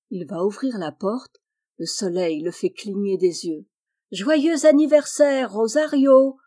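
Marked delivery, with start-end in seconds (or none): 4.1-6.4 high, loud festive shout; joyous birthday cheer. A narrator's reading. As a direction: cheer it out together. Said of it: "chantent tous ses amis". (les amis fêtent Rosario, joyeux, aigu et fort)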